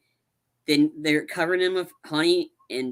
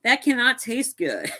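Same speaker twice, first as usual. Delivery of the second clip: high, slow grossed-out verdict; disgusted by the colored milk; mock disgust; 9 semitones higher than usual. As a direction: draw it out, grossed out. Retold disgust.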